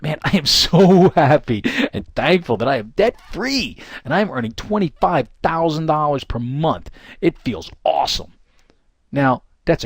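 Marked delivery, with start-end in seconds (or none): 0.0-8.3 slow, excited, with a laugh of joy; with conviction. Shown as a demonstration. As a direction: say it like you truly believe it. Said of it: "You've gotta say that".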